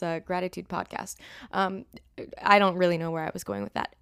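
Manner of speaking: usual speaking voice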